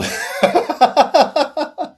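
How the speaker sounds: sudden high loud laugh; burst of laughter. Real, not acted. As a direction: crack up at your own joke. Laughs at own analogy.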